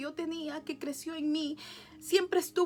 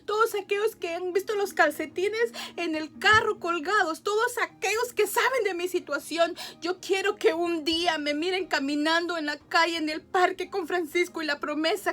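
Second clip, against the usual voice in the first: louder, building to a fervent proclamation; preaching at full force; 3 semitones higher, 7 dB louder than usual. Real, not acted. Declaring to the church her restored marriage, fervent and loud.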